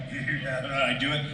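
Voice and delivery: ordinary talk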